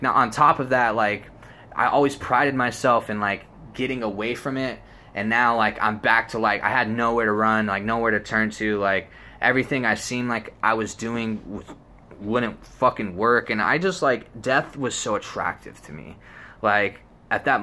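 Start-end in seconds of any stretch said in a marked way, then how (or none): none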